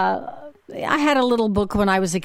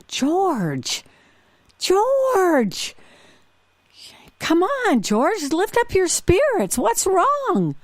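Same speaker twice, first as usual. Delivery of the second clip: pleading friend; 12 semitones higher, much slower than usual. Retold or imitated. Friend pleading with sad George.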